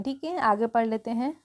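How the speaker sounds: ordinary delivery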